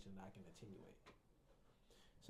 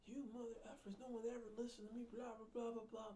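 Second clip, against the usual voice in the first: much higher, whiny mock patient tirade; mock angry rant; 11 semitones higher than usual. Angry patient rants at the therapist, mock high and whiny. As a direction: ham up an angry patient ranting. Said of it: "when they're angry".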